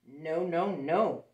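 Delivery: loud, firm no from the little wolves; little wolves refusing. Acted. Little wolves refusing the pig, loud and firm. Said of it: "said the three little wolves".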